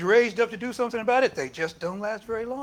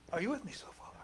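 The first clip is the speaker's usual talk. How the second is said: usual speaking voice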